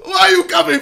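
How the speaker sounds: high, loud mock shout; Brian. Reacting streamer mimics the video's dog, shouted impression. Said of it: "what Brian sounds like".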